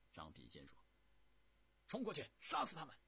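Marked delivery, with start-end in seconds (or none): 1.9-3.0 loud, high, rapid-fire order; urgent battle shout. A narrator's reading. Zhang Bixian urging a charge to kill them, urgent shout. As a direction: urge the troops on at full tilt.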